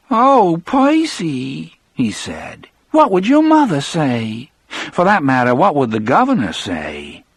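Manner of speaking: high, teasing sing-song voice; mock him like a scolding aunt; mocking Broodle. Broodle mocks Percy.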